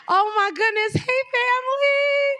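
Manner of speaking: high, loud, drawn-out greeting; excited shout. Real, not acted. Host greeting the family at a live show, excited shout. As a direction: shout hello to a whole crowd.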